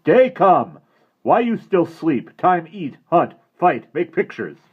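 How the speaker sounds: loud, high call across a canyon; Indian shouting. A narrator's reading. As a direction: bellow the wake-up call like a warrior. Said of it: "the Indian shouted".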